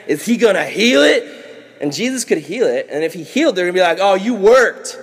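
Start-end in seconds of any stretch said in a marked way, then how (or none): 0.1-1.2 raised, put-on nosy anticipation; hammed-up watchful accuser; mock suspense. Pharisees watching Jesus, acted suspense. 4.0-5.0 high, loud gotcha; hammed-up scandalized tattling; mock accusation. Pharisees pouncing on the healing.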